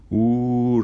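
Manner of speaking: loud, high mocking "Uh"; laughing jeer. A narrator's reading. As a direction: hoot back at him, laughing. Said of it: "repitieron todos riendo".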